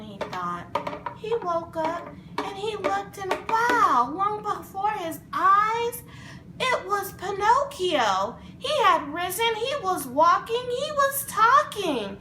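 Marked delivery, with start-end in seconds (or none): 1.2-12.2 louder, higher, building with joy; excited narration. A narrator's reading. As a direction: tell it like great news bursting out.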